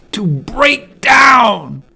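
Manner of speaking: drawn out forceful stress on "break down"; slow emphatic punch. Real, not acted. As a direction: break down said slow and loud, each word hit. Emphatic point in monologue.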